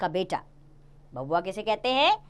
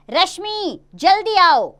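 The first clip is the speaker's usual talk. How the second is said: higher, louder alarmed call; urgent call; 10 semitones higher, 6 dB louder, slower than usual. Acted. Mother calling daughter urgently after husband collapses. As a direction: call her in a panic.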